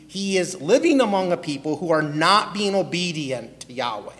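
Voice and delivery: ordinary talk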